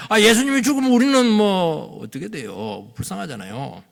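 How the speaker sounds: acted plaintive pleading, rising as it builds; hammed-up distressed objection; protesting disciple. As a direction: mimic a disciple begging against the plan. 베드로의 만류를 흉내.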